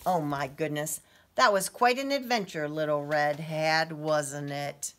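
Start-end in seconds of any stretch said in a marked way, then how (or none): none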